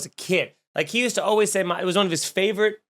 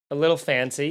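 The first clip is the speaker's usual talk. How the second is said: ordinary delivery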